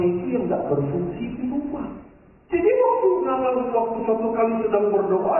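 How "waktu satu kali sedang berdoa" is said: ordinary talk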